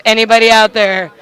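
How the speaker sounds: loud appeal. Real, not acted. Appealing to listeners.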